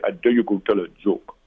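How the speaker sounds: put-on a half-hearted German accent; lightly sketched German exchange student accent; weak German accent. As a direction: try a German accent and barely land it. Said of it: "the best German accent I can give you".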